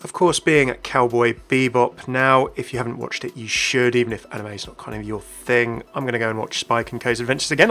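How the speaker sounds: usual speaking voice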